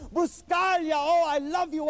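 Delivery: high, loud, gushing fan greeting. Retold or imitated. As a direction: burst out with adoring joy. Stranger runs up gushing.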